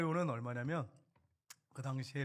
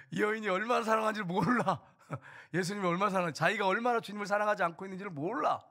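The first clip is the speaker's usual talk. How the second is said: raised, higher voice driving the point home; forceful preaching; 5 semitones higher, 6 dB louder than usual. Real, not acted. Pastor rebuking the congregation, forceful raised voice.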